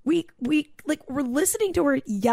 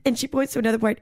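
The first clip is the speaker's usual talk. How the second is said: ordinary delivery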